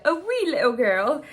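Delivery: loud, playful mock-Irish accent. Shown as a demonstration. Repeating her accidental slip, exaggerated Irish accent. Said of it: "say that in Irish accent".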